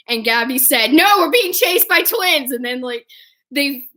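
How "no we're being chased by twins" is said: high-pitched retold protest; mock alarm. Friend explains they are being chased, high mock alarm. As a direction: blurt it in comic alarm.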